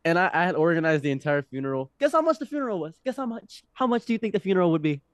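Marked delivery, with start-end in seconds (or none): 2.0-5.0 high and fast, playfully teasing; teasing quiz. Real, not acted. Teasing friend to guess the funeral cost, excited. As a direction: dare him to guess.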